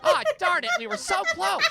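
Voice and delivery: high, laughing mock disappointment; mock foiled villain. Mocking the girl's foiled scheme, high and laughing. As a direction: play the thwarted schemer, laughing.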